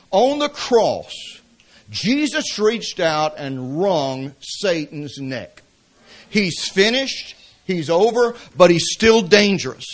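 slow, emphatic preaching. Acted. Pastor warning church about Satan, slow emphatic preaching. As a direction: press each phrase home slowly. Climax of sermon illustration.

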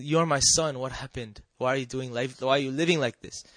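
usual speaking voice

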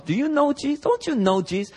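high-pitched mock questioning; nosy bystander. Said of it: "Another person comes".

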